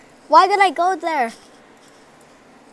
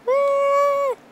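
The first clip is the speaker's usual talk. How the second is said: excited; 13 semitones higher than usual. Real, not acted. Gleeful cheer while falling.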